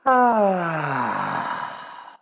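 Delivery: high ah sliding down; full release sigh; audible sigh. Shown as a demonstration. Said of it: "One more time".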